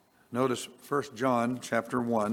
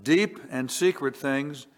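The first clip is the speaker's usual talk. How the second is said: raised, measured reading of scripture; 4 semitones higher, 4 dB louder, slower than usual. Acted. Preacher reading scripture to congregation, raised and measured. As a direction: read it out as holy words.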